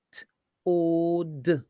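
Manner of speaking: "t, o, d" as separate sounds; phonics-style toad in three sounds; sounded out. Shown as a demonstration. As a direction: hold the "o" long between crisp t and d.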